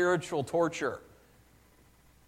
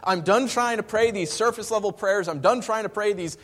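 higher, louder frustrated plea; fed up, pour it out; preacher voicing a worn-out believer; exasperated prayer; 8 semitones higher, 9 dB louder than usual. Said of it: "you're finally like".

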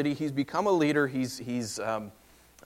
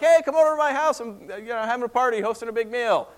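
eager invitation; 8 semitones higher, 4 dB louder than usual. Retold or imitated. Lot inviting a stranger home.

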